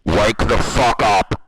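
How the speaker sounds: slow, booming shout; yell. Pretend. Streamer blasting the mic at chat, booming shout. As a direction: bellow it to wake them.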